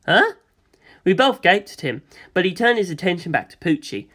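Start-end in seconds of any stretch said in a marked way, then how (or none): 0.0-0.3 startled. Acted. Narrator startled by revelation.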